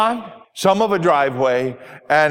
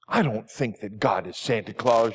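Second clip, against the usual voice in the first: low, softer, a touch hurt; a little upset; 6 semitones lower, 6 dB quieter than usual. Acted. Ruth answering her pastor, quietly and a little upset. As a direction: push back quietly, a little stung. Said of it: "a little upset".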